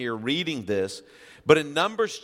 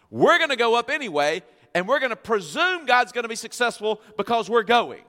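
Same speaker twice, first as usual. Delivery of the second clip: much higher, loud, taunting defiance; defiant crowd; 8 semitones higher, 5 dB louder than usual. Acted. Israelites defying Moses, loud and high taunting. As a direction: jeer at Moses like a stubborn mob.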